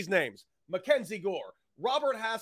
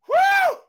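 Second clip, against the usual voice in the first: very high, loud whoop; celebratory woo; 8 dB louder than usual. Real, not acted. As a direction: let out a big whoop. Celebrating the trade.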